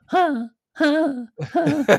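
high, loud panting grunts; tortoise grunts. Retold or imitated. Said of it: "the tortoise go like".

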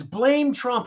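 high-pitched mock chant; mocking Democrats. Host answers what their playbook is, high mocking parroting. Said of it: "What is their playbook?".

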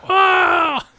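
sudden high yelp of shock; shocked shout. Retold or imitated. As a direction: cry out in horror. Retold shocked cry at injury.

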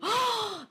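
sudden high gasp of shock; sharp gasp. Real, not acted. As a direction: gasp at your own words. Reacts to her own slip.

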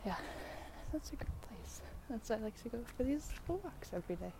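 soft near-whisper. Real, not acted. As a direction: hush it down almost to a breath. Whispering over walk noise.

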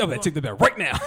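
hammed-up outrage, very loud; mock angry shout. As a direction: shout it like scolding a crowd. Acting out confronting the crowd.

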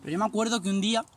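normal talking voice

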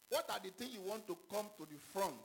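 ordinary talk

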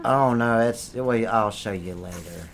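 very low, soft voice; hushed gift-giving. Acted. Presenting a present to Johnny, hushed and low. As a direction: speak low and softly, unhurried. Dodging question about the gift.

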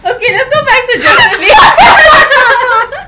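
loud laughing call, slow and high; laughing shout. Real, not acted. Laughing loud call back to topic.

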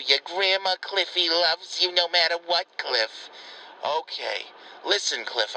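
ordinary delivery

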